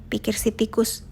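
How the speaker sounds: ordinary delivery, nothing marked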